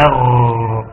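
«أر» with a held, gargled غ; gargled ghayn. Shown as a demonstration. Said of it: "عشان كده سموه حرف غرغرة". (صوت غرغرة الغين الممتد في «أر»)

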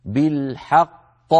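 بالحق slowly, with the final qaf bouncing; qalqala demo. Shown as a demonstration. Teacher showing students the qalqala in بالحق. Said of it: "هكذا".

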